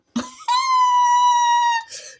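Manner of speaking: high, loud squealing laugh; shrieking laugh. Real, not acted. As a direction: burst out in a shrill cackle. Laughs at her own joke.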